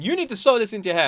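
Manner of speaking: fast, bossy mock order; pushy stylist. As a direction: rattle it off like a pushy boss. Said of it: "I've got this guy going".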